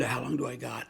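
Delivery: a hint of a steroid-roughened voice; gravelly voice. Dying father asking the doctor, soft mock gravelly voice. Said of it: "his voice was real gravelly".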